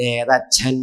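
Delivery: drawn-out, detached question; reply like an indifferent spirit, lingering on the last sound; put-on voice from the beyond; distant soul asking. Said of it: "അവിടുന്ന് ഇങ്ങോട്ട് ചോദിക്കുക".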